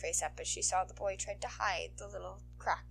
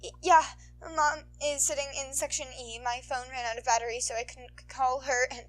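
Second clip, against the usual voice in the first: put-on higher, stammering kid voice; sniffling boy; 5 semitones higher, 7 dB louder than usual. Boy explaining to the heroine, stammering. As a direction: get it out between sniffles.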